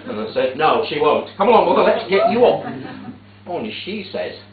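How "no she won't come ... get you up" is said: louder, fast push to get her up; brisk loud urging. Retold or imitated. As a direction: hustle Mother up off the ground. Hurrying mother up from pavement.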